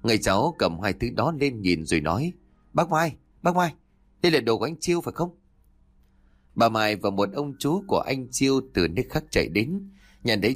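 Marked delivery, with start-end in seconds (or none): 2.7-5.4 high, quick urgent call. A narrator's reading. Nephew finds cousin's belongings and calls his aunt, urgently. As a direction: call out to your aunt in alarm.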